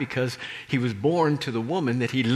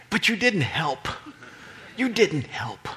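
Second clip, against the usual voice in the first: ordinary delivery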